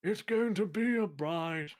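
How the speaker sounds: put-on slow, high, loud old man voice. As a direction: warble it like a creaky old man. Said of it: "the old man would be like".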